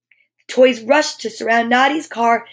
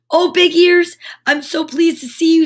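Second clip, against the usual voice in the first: louder, higher glad cry; relieved and excited; 6 semitones higher, 5 dB louder than usual. Acted. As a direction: greet a rescuer with relief. Relieved greeting.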